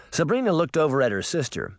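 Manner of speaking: ordinary delivery, nothing marked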